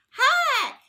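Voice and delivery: put-on high, loud cheery hello to small children; a big friendly hi called down to kids in a cart; bright greeting. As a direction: sing out hello to the little boys.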